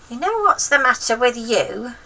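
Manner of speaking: put-on high, loud cranky old woman; grandma voice. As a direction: nag the boy like a spiteful old grandma.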